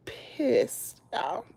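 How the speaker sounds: voiceless whispered word; whispered fury. Real, not acted. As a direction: breathe it out in anger. Stating her anger.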